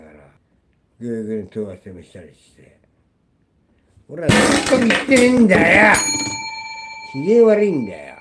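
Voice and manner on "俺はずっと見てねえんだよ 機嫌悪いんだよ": real furious yell, far higher and louder; angry shout. Real, not acted. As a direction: blow up in genuine rage. Furious rant about a ban. (本気の怒鳴り声)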